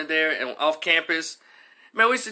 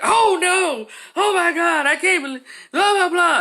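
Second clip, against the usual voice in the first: very high, loud hammed-up uproar; high-pitched mock horror; mock outrage; 14 semitones higher, 4 dB louder than usual. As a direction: wail like scandalized classmates, mockingly. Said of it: "it just went haywire".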